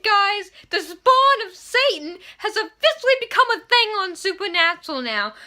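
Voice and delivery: high, loud, breathless fangirl shriek; near-scream of excitement. Retold or imitated. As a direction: scream it like the plot twist just hit you. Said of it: "I was almost screaming".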